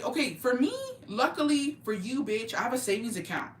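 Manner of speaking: ordinary talk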